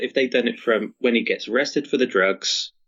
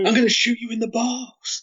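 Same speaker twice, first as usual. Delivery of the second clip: high, strained villain outburst; mock villain threat; 12 semitones higher than usual. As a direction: throw out a high-pitched, hammed-up threat. Acting out Bennett's threat.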